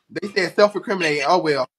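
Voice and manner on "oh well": ordinary talk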